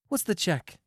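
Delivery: high, brisk demand from Marcia's character. A narrator's reading. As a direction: ask briskly, pitched well up. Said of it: "she demanded briskly".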